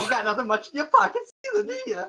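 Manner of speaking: high, chuckling pickpocket; laughing thief. Acted. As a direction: laugh through the line as a sly thief. Thief NPC voiced, laughing.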